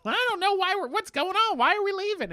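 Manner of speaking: high, whiny, bewildered voice; mock high whine of a lost prince; whiny Charles. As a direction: pitch way up as the confused prince. Charles voiced whining in recap.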